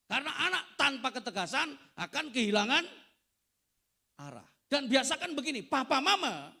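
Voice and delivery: loud, high preacher's call; full force preaching. Acted. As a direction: thunder it from the pulpit. (khotbah berapi-api dengan suara keras)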